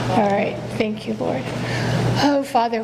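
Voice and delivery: ordinary talk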